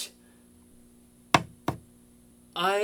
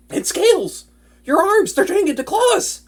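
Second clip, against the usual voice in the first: high, louder, rising alarm; 12 semitones higher, 5 dB louder than usual. Acted. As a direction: blurt it out as the change speeds up. Watching friend transform.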